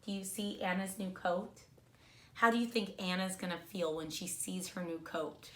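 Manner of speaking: plain reading voice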